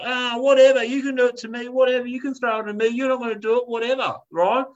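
put-on a cheeky kid daring mum; defiant kid taunt. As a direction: goad her like a bratty child. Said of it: "he's like".

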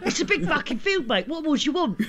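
high, laughing cartoon bloke; mock bloke voice. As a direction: ham up a pushy producer. Mocking producer voice, laughing.

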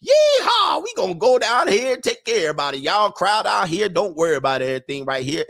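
high, loud mock Southern whoop; hammed-up Southern accent. Host imitates Brian Kelly in Baton Rouge, high hollering Southern accent. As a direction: holler it like a caricature Southern coach. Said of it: "and he was like".

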